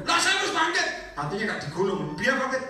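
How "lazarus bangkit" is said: much higher, loud command; commanding call. Shown as a demonstration. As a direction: call Lazarus out of the grave.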